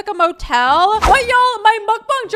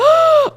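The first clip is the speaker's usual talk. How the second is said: loud, high-pitched gasp; delighted gasp; 12 semitones higher, 8 dB louder than usual. Real, not acted. Player spotting French toast on the menu, delighted gasp. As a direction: suck in a loud breath of delight.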